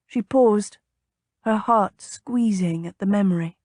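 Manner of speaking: plain reading voice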